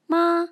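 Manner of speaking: "Ma" high, loud and level; first tone. Shown as a demonstration. Teacher leads repeat-after-me drill, "Ma" high and level. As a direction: hold "Ma" steady on a high pitch. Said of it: "using the five tones".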